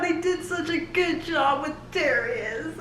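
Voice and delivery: ordinary talk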